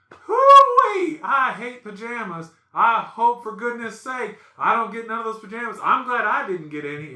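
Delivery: high, loud mock griping; very audible griping. Dumb Nick griping with brother-in-law, loud mock complaining. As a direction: open on a whoop, then complain for the whole room. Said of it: "we're very audible about it".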